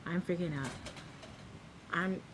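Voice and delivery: ordinary delivery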